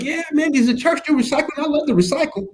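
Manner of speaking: hammed-up high enthusiastic voice; eager young recycler. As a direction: play an excited volunteer begging to talk recycling. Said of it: "they've been coming up like".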